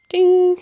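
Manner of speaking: high imitated ding; high "ding" like a message alert; phone notification ding. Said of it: "That was Facebook in my phone".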